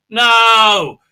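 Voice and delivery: hammed-up pleading no; mock dismayed no. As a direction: wail the refusal in fake despair. Acts out dismayed reaction.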